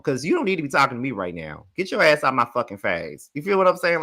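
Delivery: normal talking voice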